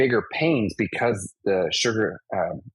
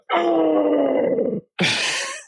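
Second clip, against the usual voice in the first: high, pained gasp as the wind is knocked out; a retold gasp of pain from the impact; sharp gasp; 3 dB louder than usual. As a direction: suck in air like your ribs just hit the floor. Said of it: "and I go".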